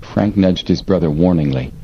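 usual speaking voice

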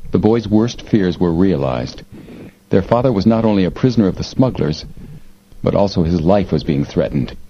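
ordinary delivery, nothing marked

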